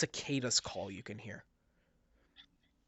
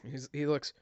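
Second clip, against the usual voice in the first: usual speaking voice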